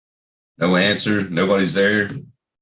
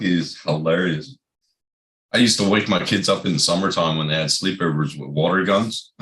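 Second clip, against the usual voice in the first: usual speaking voice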